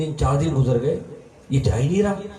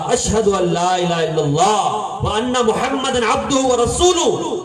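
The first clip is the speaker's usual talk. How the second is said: slow declaration rising higher at the end; fervent slow recitation; 4 semitones higher, slower than usual. Acted. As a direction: recite the creed slowly with mounting fervour. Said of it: "اور کہا".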